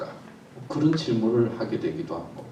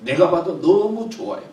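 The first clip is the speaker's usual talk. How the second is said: normal talking voice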